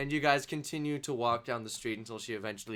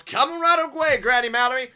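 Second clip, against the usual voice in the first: high, loud holler; chef calling out; 12 semitones higher, 10 dB louder than usual. Acted. Chef answers granny's order, loud eager call. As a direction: holler it out high across the room.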